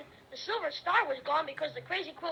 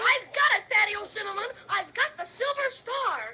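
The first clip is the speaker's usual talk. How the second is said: a louder, higher cry of triumph; triumphant shout; 4 semitones higher, 4 dB louder than usual. Acted. Boy triumphantly telling the bear he grabbed the star, excited shout. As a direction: shout it out as you grab the prize.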